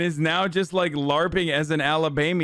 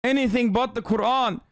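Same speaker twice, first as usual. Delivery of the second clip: put-on high-pitched comic Middle Eastern accent; indignant, hammed-up Middle Eastern accent; mock Taliban accent; 7 semitones higher than usual. Taliban recruiter ranting about women and Lululemon, mock Middle Eastern accent. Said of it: "The Taliban shows this compilation".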